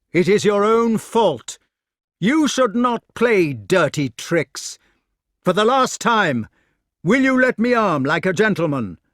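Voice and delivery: high, loud scolding demand. A narrator's reading. Knight up a tree to the trickster below, scolding loudly. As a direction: call down a last warning.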